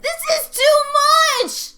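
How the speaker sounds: high, loud anguished cry; outcry. Real, not acted. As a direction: wail it at the screen. Yelling at the ending.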